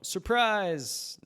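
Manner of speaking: high, loud party yell; yelled surprise. Acted. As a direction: shout it out for the birthday. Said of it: "a toast to yell".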